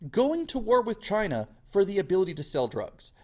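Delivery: ordinary talk, nothing marked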